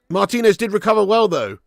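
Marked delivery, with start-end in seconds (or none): none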